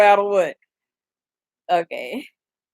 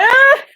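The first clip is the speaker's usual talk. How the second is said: high loud fake burst of laughter; mock audience laugh; 7 dB louder than usual. Mimicking the audience to viewers, a high, loud fake laugh. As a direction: belt out a hammed-up crowd roar. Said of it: "people just be like".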